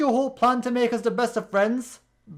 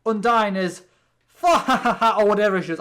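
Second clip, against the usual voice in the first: a quick hammed-up laugh dropped into the sentence; mock anime-style laugh; imitating Undyne's laugh; 4 dB louder than usual. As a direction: do her signature laugh for a beat, a touch louder. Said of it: "anime characters have unique laughs".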